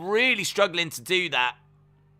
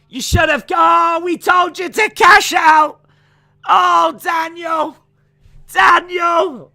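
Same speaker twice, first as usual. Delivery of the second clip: high, loud shouting, then fading on the repeats; frustrated yelling; 12 semitones higher, 7 dB louder, slower than usual. Real, not acted. Host to chatter Daniel, shouting that he should have cashed out. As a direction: bawl him out for not cashing out. Yelling at chat member who lost.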